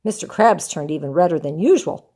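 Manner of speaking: ordinary delivery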